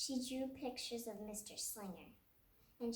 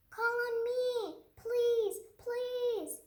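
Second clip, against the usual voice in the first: much higher, louder, slow pleading; eager begging; 11 semitones higher, 8 dB louder, much slower than usual. Acted. Lily begging the teacher to call on her, high, loud and pleading. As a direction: beg to be called on, drawn out.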